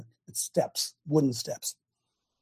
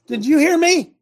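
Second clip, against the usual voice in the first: high, loud demand; sharp raised-voice scolding of a grandchild; scolding grandparent; 10 semitones higher, 7 dB louder than usual. Acted. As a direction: pitch it up and demand an answer. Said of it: "ever said to your grandchild".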